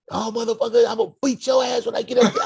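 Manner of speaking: high furious mom threatening, fast; be the mom screaming down the phone; angry mother. Retold or imitated. Mother on the phone threatening her son, high and furious.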